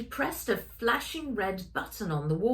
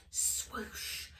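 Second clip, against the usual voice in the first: whispered swoosh sound; 9 dB quieter than usual. Acted. Door sound effect.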